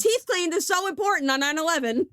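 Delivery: high, biting sarcasm; mock how absurd it was; real scorn for the employer; sarcastic. Real, not acted. Mocking the employer's priorities.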